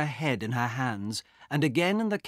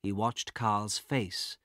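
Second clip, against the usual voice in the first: plain reading voice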